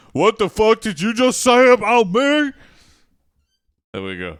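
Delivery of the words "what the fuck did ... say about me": loud, high-pitched outburst from an offended troll; belting out an outraged troll's challenge; angry troll. Acted. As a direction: roar it indignantly as the big troll. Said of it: "a big ass troll is, like, sitting in the back and he goes".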